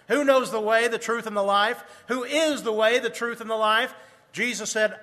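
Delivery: ordinary talk, nothing marked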